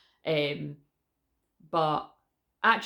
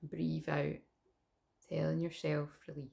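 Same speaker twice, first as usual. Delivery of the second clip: guided meditation; 6 dB quieter than usual. Acted. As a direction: guide them calmly into the breath. Leads a breathing meditation.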